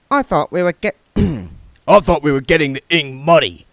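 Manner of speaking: damp growl. A narrator's reading. Mister Tulip grumbles at the box, damp growl. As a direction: growl like a heavy thug.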